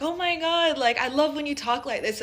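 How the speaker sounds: gushing friends. Retold or imitated. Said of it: "my American friends were like".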